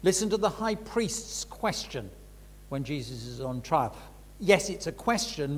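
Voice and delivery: usual speaking voice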